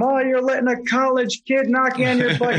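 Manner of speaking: imitated scolding yell from the bench; retold yelling. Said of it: "Starts yelling at him".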